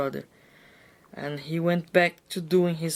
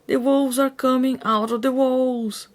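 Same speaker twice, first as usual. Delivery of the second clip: high, loud alarmed shout; 8 semitones higher, 6 dB louder than usual. A narrator's reading. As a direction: yell the warning. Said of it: "shouted Lucy's father".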